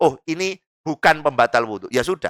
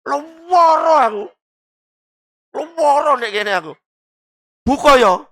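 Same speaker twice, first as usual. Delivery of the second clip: whining sick complainer; 9 semitones higher than usual. Acted. Feigning illness to get out of fasting, whiny. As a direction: moan about the pain in a put-on whimper.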